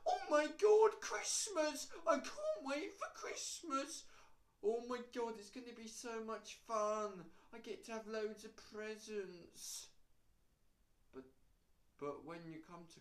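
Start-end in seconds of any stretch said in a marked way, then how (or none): 0.1-4.0 high, loud mock-excited squeal; hyped-up Christmas fan. Mocking people who can't wait for Christmas, hammed-up excitement. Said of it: "some people are like". 4.6-9.9 high-pitched mock excitement, stretching the last words; gushing Christmas fan. Mocking people looking forward to presents, hammed-up excitement. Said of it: "some people are like".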